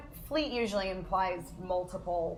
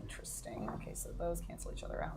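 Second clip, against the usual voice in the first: whispering; 8 semitones lower, 14 dB quieter, faster than usual. Real, not acted. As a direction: drop to a breathy hush. Baby sleeping nearby, whispering.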